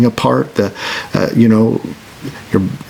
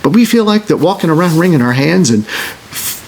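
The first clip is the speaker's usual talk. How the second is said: ordinary delivery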